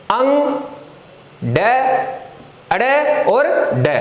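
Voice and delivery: अंग, ड, अड़, ड each said clearly and apart; pronunciation demo. Shown as a demonstration. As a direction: sound out अंग then ड then अड़ then ड with pauses. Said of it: "ये इनका उच्चारण है".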